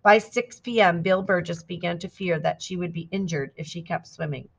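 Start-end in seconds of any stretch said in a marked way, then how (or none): none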